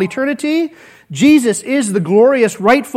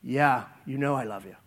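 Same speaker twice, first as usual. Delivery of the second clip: softer, lower, hurt Peter; humble quiet answer; 10 semitones lower, 13 dB quieter than usual. Retold or imitated. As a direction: answer Jesus barely above a murmur.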